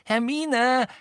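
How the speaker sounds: loud, high-pitched exasperated outburst; snapping angrily. A narrator's reading. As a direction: snap at her, voice pitched right up. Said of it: "fuhr Ron sie nun an".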